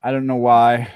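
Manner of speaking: normal talking voice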